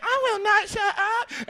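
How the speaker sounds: slow, very high falsetto refusal; mock indignant girl. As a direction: refuse in a drawn-out falsetto girl's voice. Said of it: "And she's like".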